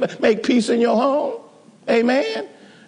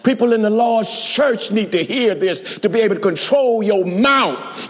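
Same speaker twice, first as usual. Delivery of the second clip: usual speaking voice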